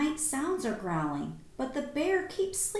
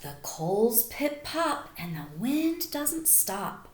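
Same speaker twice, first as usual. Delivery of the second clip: put-on slow, lilting read to children; storybook read-aloud; 5 semitones lower, slower than usual. A narrator's reading. Teacher reading a bedtime story to the class, lilting and slow. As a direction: savour each line for the class.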